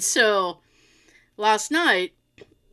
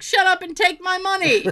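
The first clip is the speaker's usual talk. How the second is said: higher, eager mock plea; 8 semitones higher than usual. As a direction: beg them to take your money.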